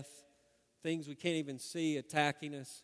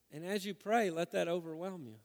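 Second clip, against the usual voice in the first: normal talking voice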